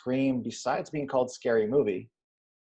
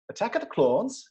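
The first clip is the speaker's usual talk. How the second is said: incredulous echo; 9 semitones higher than usual. Retold or imitated. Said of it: "He's like".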